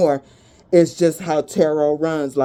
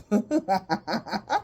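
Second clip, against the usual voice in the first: laugh; 4 semitones higher, 6 dB quieter than usual. Real, not acted. Laughs after shut up.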